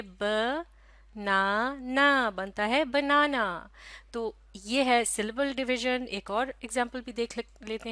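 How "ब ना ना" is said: ब, ना, ना as three separate drawn-out beats; syllable by syllable. Shown as a demonstration. Teacher showing how to teach a child the word, syllable by syllable. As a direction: sound out ब, ना, ना with gaps between. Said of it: "किस तरह से कहेंगे".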